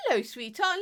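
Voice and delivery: ordinary talk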